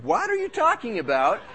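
high-pitched, bewildered comeback; retold bafflement. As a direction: act out your own baffled protest. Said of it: "I'm going".

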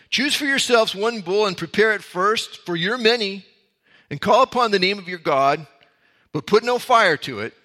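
Elijah's challenge. Acted. Elijah's challenge read aloud.

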